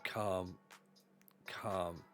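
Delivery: ordinary talk, nothing marked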